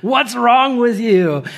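high, loud imitation of a yelling child; mock screaming toddler. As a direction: holler like a little kid stuck in a crib. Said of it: "he just starts screaming at me".

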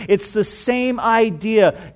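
ordinary talk, nothing marked

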